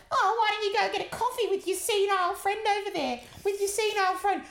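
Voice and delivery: high, loud sneering voice; snide young woman. Retold or imitated. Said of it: "she was like".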